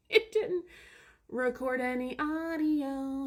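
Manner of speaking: slow laughing realization; laughing disbelief. Real, not acted. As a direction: laugh at the disaster. Laughing at lost recording.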